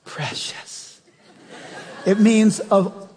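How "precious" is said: soft breathy "Precious" with a laugh in it; whispered precious. Shown as a demonstration. Preacher savoring the word precious, whispered. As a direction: breathe "Precious" like a treasured secret. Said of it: "he'll use the word precious".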